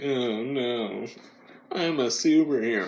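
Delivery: put-on moaning heroine voice; hammed-up mock dismay; mock reluctant superhero. Podcaster mocking a whiny Jessica Jones, put-on lament. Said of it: "watch this woman be like".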